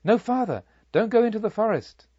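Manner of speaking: high, loud urgent warning cry; calling out a warning. A narrator's reading. Villagers warning the traveller away from the forest, high and loud. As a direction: villagers call out to stop the monk. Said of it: "I could hear them calling to him".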